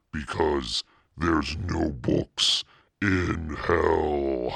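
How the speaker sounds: very slow, demonic drawl; devilish voice. Acted. Satan host signing off to listeners, demonic drawl. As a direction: menace the listeners playfully.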